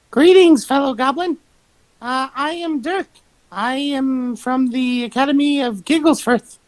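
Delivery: a touch of a formal goblin; goblin greeting. Acted. Dirk greeting a fellow goblin, lightly voiced and formal. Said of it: "Dirk in Goblin just says".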